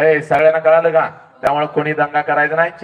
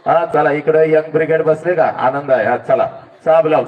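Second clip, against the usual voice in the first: ordinary delivery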